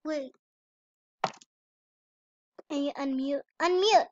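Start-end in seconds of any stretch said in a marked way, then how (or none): 3.6-4.1 calling out. Real, not acted. Calling sister to unmute.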